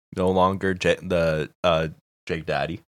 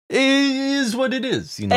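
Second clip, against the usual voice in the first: mock shrug voice. Voicing Cuomo's shrug.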